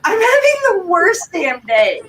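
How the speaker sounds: high, slow, half-crying complaint; retold tearful plea. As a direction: plead with the officer near tears. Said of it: "I start crying".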